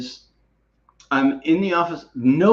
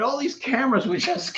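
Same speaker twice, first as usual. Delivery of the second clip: excited telling; 10 semitones higher than usual. Retold or imitated. Mimicking camera flashes going off.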